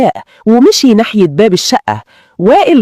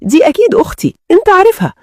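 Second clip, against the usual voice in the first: much higher-pitched, uneasy excuse; higher voice feigning a casual explanation; nervous brush-off; 10 semitones higher than usual. A narrator's reading. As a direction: pitch it up, playing nervously light. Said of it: "وقلت بضحكة مهزوزة".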